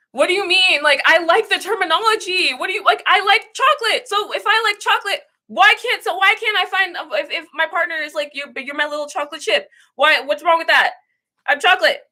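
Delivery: high-pitched, exasperated pleading; indignant protest. Real, not acted. Streamer defending chocolate as a term she likes, indignant protest. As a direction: argue back in a high, incredulous voice.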